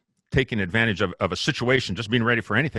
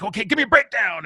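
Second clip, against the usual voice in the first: host directing the band; 10 semitones higher, 3 dB louder than usual. Retold or imitated. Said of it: "He's like".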